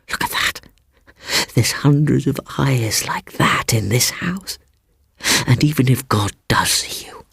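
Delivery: breathy whisper. A narrator's reading. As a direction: let the words fall to a whisper. Seaton whispering.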